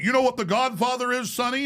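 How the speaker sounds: patronizing mobster. Retold or imitated. Mobster condescending to him, lightly voiced wiseguy. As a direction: talk down to the kid like a wiseguy.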